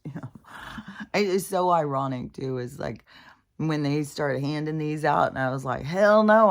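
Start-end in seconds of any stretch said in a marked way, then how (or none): 0.1-0.3 exasperated whisper. Real, not acted. Speaker feeling so shitty, whispered exasperated aside. Despairing aside after the plea. 5.8-6.5 loud, high-pitched outright no; emphatic refusal. Retold or imitated. Speaker refusing what they started handing out, loud emphatic refusal. Retelling refusal when these handed out.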